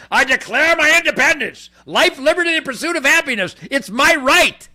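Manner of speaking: high, loud, fired-up proclamation; loud rally-speech declaration; shouted declaration. Real, not acted. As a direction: roar it like a rally cry.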